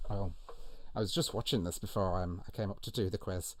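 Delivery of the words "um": ordinary talk, nothing marked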